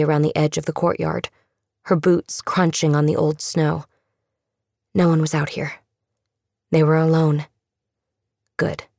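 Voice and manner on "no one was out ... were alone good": usual speaking voice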